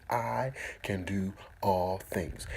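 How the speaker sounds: slow, voiced, together; slow affirmation. Shown as a demonstration. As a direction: say it slowly with them. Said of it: "Say it with me".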